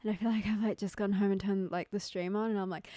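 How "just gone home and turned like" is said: ordinary delivery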